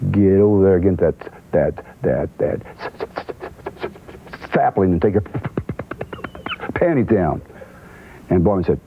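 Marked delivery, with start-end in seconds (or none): 0.1-7.4 lightly voiced stammering country man, repeating "that" haltingly; imitating Cowboy's stutter. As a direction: stumble on "that, that, that" like a nervous non-reader. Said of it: "So Cowboy goes".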